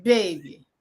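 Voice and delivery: put-on repeating "Baby." in a New Orleans drawl; New Orleans accent. Shown as a demonstration. Host asked to repeat the word, New Orleans accent. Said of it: "You say it again for us".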